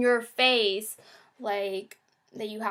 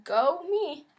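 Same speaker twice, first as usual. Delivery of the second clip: bright, high, cheery self-congratulation; relieved self-praise; 5 semitones higher, much slower than usual. Retold or imitated. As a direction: cheer yourself on, pitched well up. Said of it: "and I was like".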